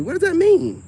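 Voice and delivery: high, loud disbelief; incredulous. Real, not acted. Reacting to women's claim, a high incredulous question. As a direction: squeak it out in mock outrage.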